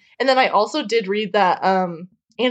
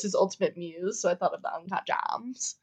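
whispering; 4 semitones lower, 10 dB quieter than usual. Real, not acted. Aside about gossip.

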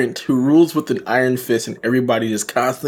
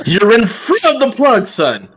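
louder and much higher mock father; 10 semitones higher, 4 dB louder than usual. Host mocking a dad to his clueless son, hammed-up. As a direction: tease like an exasperated dad.

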